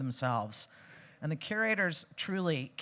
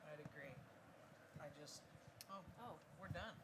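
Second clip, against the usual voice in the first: off mic; 23 dB quieter, faster than usual. Real, not acted. Mic cut off mid-panel.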